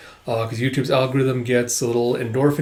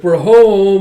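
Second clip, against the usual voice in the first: loud, high, sing-out call; calling out arrival; 6 semitones higher, 10 dB louder than usual. Acted. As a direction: announce it to the whole house. Second character calls out arrival.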